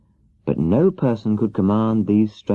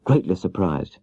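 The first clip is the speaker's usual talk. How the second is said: ordinary delivery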